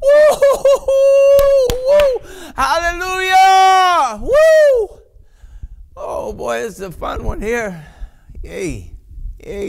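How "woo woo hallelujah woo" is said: high, loud, drawn-out hallelujah; real rapturous whoops; ecstatic shout. Real, not acted. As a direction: cry out overwhelmed. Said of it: "It comes back to me".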